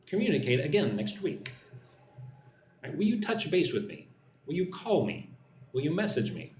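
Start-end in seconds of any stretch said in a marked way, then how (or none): none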